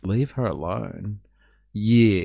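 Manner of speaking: ordinary delivery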